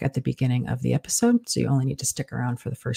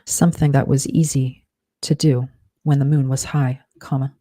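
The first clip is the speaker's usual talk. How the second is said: slow dictation, punctuation spoken aloud; dictating; slower than usual. Shown as a demonstration. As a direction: dictate it word by word with pauses.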